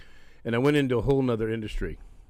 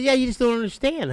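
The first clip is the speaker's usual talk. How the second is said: giddy fan; 12 semitones higher, 4 dB louder than usual. Retold or imitated.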